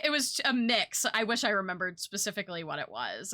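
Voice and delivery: ordinary delivery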